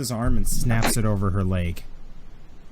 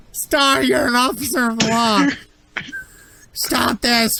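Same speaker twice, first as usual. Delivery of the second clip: high, loud, hammed-up wailing; pained desperate plea; 12 semitones higher, 5 dB louder than usual. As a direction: howl for the lawman to step in. Victim repeats his plea to officer.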